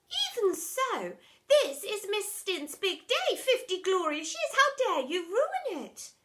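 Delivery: secretary scolding the deputy head, indignant. Acted. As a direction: scold her slowly from up high.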